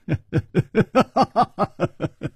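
long high-pitched staccato laugh. A narrator's reading. Chu Liuxiang laughing at the exposed impostor, high. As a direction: let out a prolonged acted laugh.

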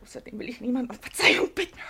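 breathless whispered refusal. Acted. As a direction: whisper it, almost to yourself. Pauline whispers defiantly.

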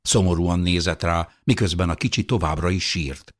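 usual speaking voice

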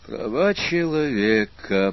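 ordinary delivery

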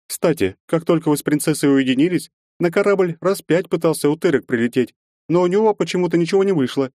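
lightly voiced sly older woman; woman's voice, teasing. Marya Ivanovna teases hero.

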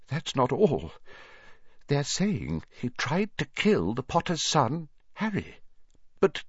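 high, trembling, halting account. A narrator's reading. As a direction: struggle through dreadful news, voice trembling. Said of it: "Professor McGonagall's voice trembled".

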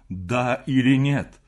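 slow, loud, weighted demand; insistent question. A narrator's reading. Repeats the demand after silence. (медленно, громко, настойчиво)

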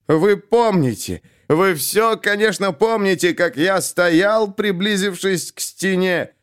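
put-on drunk man declaiming Esenin with strain, high and slow; anguished poetry recital. A narrator's reading. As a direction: tear through the poem, voice straining. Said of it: "с надрывом выкрикивая в микрофон". (надрывная декламация пьяного)

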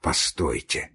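low, soft single word; weak dying voice. A narrator's reading. Dying man asks them to wait, weak and low. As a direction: ask faintly, with little strength.

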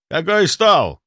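loud, high barked question; barking. A narrator's reading. Captain demanding which table it is, barking. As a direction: bark it like a captain in a hurry. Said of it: "рявкнул Стрельцов".

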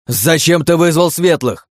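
loud urgent shout. A narrator's reading. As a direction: yell it right into his ear. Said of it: "прокричал чёрный мне в ухо".

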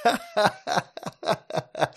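high slow belly laugh. Acted. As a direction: laugh till tears come. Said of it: "смеялся лучник".

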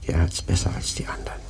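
quick creepy whisper; fast whisper. Acted. Prophet whispering a dark joke to the sailors. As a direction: rush it out under the breath.

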